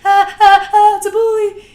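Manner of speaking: high, loud frightened squeal; hammed-up terrified cry; mock panic. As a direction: shriek it like a scared kid. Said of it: "Instead of you being like".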